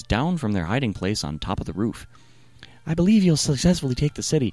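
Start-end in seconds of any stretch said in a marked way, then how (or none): 2.8-4.5 higher, quick woman's voice; rushed confiding to the spies; Rahab. Acted. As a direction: Rahab whispering plans to spies. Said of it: "Rahab to the spies".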